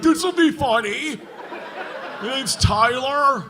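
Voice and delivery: put-on slow, smug dumb-kid voice; a hint of a clueless frat kid; mock dumb kid. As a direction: lightly play a smug twenty-year-old. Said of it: "some twenty-year-old kid who's like".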